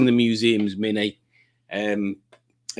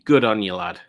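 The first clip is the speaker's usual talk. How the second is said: ordinary talk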